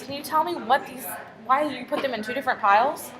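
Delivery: normal talking voice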